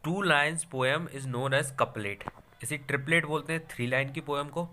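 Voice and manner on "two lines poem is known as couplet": slow dictation. Shown as a demonstration. Dictates definition.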